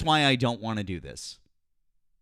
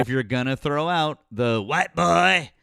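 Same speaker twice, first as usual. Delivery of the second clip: put-on slow, loud parody of a Southern accent; mocking accent imitation; 3 semitones higher, 5 dB louder, slower than usual. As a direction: mimic the caller's fake Southern accent. Throws caller's accented insult back.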